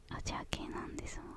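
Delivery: ordinary talk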